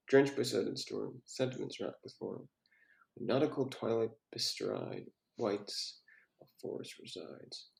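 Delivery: very soft, half-voiced poem reading; reading under breath. Real, not acted. Reader going over the student's poem, reading under breath. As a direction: read the verse quietly to yourself.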